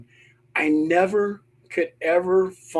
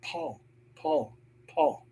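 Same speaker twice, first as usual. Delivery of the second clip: high, very soft calling; hushed, high-pitched ghost call; ghostly woman's voice; 5 semitones higher, 8 dB quieter, slower than usual. Retold or imitated. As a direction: imitate a faint female spirit calling a name. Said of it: "a woman's voice say".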